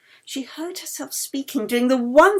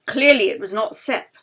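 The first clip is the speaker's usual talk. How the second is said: usual speaking voice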